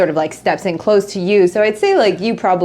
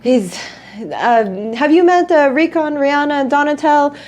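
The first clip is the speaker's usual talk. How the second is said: excited host; 7 semitones higher than usual. Acted. Host greets arriving guests, high and gushing. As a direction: gush over the guests like a thrilled host.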